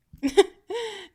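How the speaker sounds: high, loud scornful laugh; mocking laugh. Mistress scoffing at her pet's question. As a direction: laugh right in their face.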